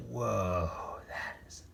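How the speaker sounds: whispered gasp; hushed aside. A narrator's reading. Narrator whispering to children, hushed. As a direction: whisper it in awe. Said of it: "spooky aside".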